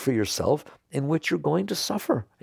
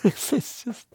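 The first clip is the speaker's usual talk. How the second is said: laughing; 11 semitones higher than usual. Real, not acted. Laughs mid-sentence.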